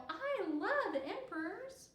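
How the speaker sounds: high, slow and dreamy; little girl swooning over emperors; adoring child. A narrator's reading. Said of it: "said Kiko".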